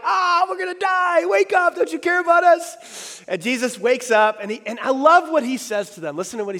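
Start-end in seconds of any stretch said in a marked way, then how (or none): 0.0-3.2 panicked shout. Acted. Disciples panic in the storm.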